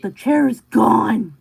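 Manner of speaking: loud, slow shocked cry. Real, not acted. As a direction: blurt it out in alarm. Player shocked the chair vanished.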